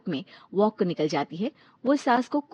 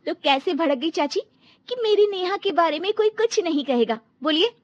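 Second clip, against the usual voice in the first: louder, much higher accusing voice; angry confrontation; 7 semitones higher, 5 dB louder than usual. Acted. Daughter-in-law confronting her mother-in-law, angry.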